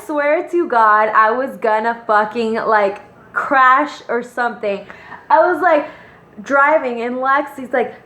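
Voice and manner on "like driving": ordinary talk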